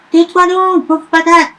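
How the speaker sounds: high, loud witch snap; witch yelling. Acted. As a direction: bark it to shut him up. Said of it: "hurle la sorcière".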